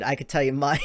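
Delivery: ordinary talk, nothing marked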